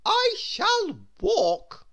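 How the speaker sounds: high, loud, slow lofty command; haughty grand lady. Acted. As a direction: dismiss money like royalty. Miss Splendid haughty.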